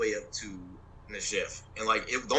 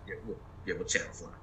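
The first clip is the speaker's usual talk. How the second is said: hushed aside; 5 semitones lower, 9 dB quieter than usual. Real, not acted. To the host, hushing the private matter: a whisper. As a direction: drop to a whisper, a bit secretive.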